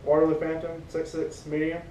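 ordinary talk

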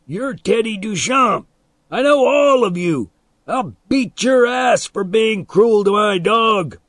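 high, angry threatening yell; shouted. A narrator's reading. Dog's owner threatens the boys, shouting high. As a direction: yell it loud and high, full of outrage. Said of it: "he shouted".

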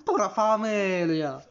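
loud, high, drawn-out mock Italian cry; Italian accent. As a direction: proclaim it slowly in a hammed-up Italian accent. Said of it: "Il prend un accent italien".

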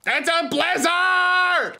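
high, loud dramatic cry; booming high-pitched announcement; excited shout. Real, not acted. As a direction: holler it out big. Calling out in the game.

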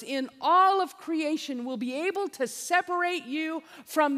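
loud, high, building preaching; fervent preaching. Real, not acted. Preacher to his flock, loud fervent preaching. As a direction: preach it hard to the congregation.

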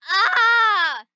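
high, loud scream that cracks; voice-cracking scream. Pretend. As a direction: scream till your voice breaks. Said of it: "voice crack".

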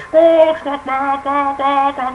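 high squawking turkey call; turkey call squawks. Pretend. Hunter blowing a turkey call, high squawking. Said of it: "I can't sound like a turkey".

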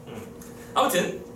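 normal talking voice

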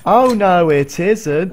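high, loud pantomime retort; panto crowd callback. Pretend. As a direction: answer back like a panto villain. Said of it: "It's so panto".

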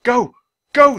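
high, loud panicked burst; frantic cry to run; sputtered. A narrator's reading. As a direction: spit out the words in panic. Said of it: "sputtered Jack".

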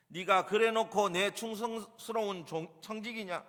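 high, loud, indignant scolding; master's stern rebuke. Acted. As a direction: reprimand a servant, hammering out each charge. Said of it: "훈계한 말입니다".

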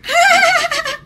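shrieking delighted laugh; high burst of laughter. Real, not acted. Cracking up as the artist starts to twitch, high laugh.

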